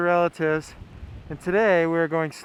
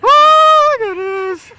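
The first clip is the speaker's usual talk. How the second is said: excited shout; 15 dB louder, much slower than usual. Real, not acted. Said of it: "We're so pumped up!".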